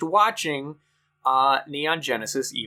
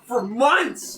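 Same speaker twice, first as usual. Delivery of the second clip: angry; 10 semitones higher than usual. Real, not acted. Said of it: "I'm so angry".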